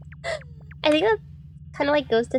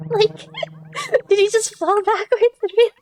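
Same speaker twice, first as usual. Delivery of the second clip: high, loud, breaking into laughter; can barely get the words out for laughing; giggling helplessly at the story; 12 semitones higher, 4 dB louder than usual. Real, not acted. Laughing fit over the story.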